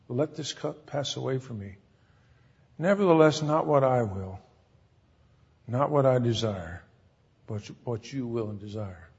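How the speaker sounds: very soft, low reading, sinking away; subdued acted submission to the Father; hushed prayer of surrender. Reads Jesus' prayer of surrender.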